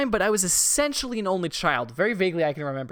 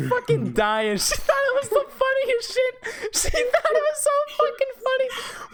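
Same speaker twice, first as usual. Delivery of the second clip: laughing. Real, not acted. Laughing while telling the story.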